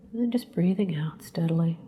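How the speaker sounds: soft unvoiced whisper on the exhale. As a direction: breathe the words out as a hush. Exhale cue in breathing exercise.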